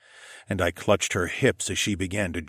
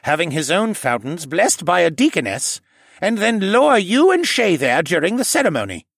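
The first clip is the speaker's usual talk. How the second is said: put-on raised, older gentle man's voice; 10 semitones higher, 5 dB louder than usual. A narrator's reading. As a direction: voice the kindly old koala man. Jenner voiced by narrator.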